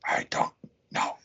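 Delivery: creepy whisper. Pretend. Whispering a creepy warning to the guy. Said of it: "I just wanted to lean over and be like".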